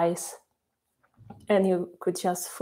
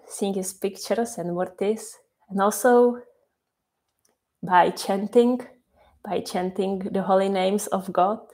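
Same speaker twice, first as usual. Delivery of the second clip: ordinary delivery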